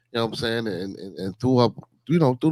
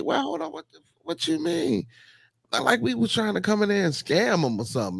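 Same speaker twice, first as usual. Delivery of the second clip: indignant disbelief; 10 semitones higher than usual. Retold or imitated. Retorting to insulting restaurant staff.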